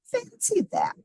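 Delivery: put-on very high old lady voice, amazed. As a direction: pipe it up like a wondering granny. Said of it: "said the little old lady".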